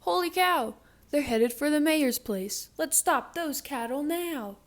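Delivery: high, loud fire chief yell. Acted. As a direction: shout orders to stop them. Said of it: "The chief yelled".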